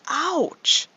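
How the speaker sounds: high, loud cry of pain; the lion yelps as the thorn pokes in; ouch. Acted. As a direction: yelp like a pricked paw. Thorn pokes the lion's paw.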